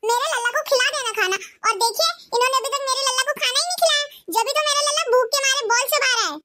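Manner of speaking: a bossy bahu telling off her mother-in-law; shrill daughter-in-law. Acted. Ekta complains to husband. (सास को डाँटती तेज़ बहू, ऊँची आवाज़ में)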